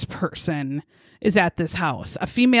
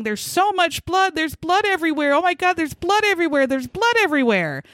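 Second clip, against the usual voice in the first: retold hysterical; 8 semitones higher than usual. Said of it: "Jenna's hysterical".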